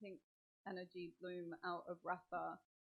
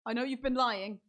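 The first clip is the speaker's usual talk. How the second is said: loud, higher-pitched accusation; accusing; 5 semitones higher, 13 dB louder than usual. Acted. Game master's character accuses the intruder of lying, loud and insistent. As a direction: confront the liar at full voice.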